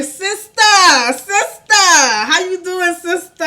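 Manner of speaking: imitated high, loud greeting; hammed-up high holler of hello; calling out. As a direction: call out to her from up the block, drawn out. Friend calls out greeting on arrival.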